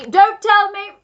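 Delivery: loud, high interruption; crying out. A narrator's reading. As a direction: cut in with a high, booming cry. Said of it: "cried the Duke".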